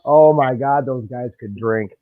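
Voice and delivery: ordinary talk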